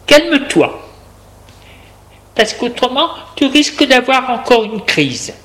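high, insistent character voice; warning carer. A narrator's reading. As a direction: caution the patient, pitched well up. Woman warns and calms him. (soignante qui met en garde, voix haute)

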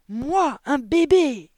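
high, very loud indignant outburst; outraged yell. A narrator's reading. Headmistress answers the boy who called her a baby, outraged yell. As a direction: yell it in sheer disbelief. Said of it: "hurla mademoiselle Le Gourdin".

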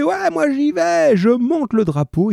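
put-on high, slow, far-off old drunkard voice; drunken old man. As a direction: play the tipsy old man stepping up. Old drunk man volunteers on barricade.

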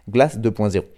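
title said in a plain French accent; French pronunciation. Shown as a demonstration. Radio host correcting himself after sounding ridiculous, in a French accent. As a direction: say the number in French, "deux point zéro". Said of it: "C'est ridicule".

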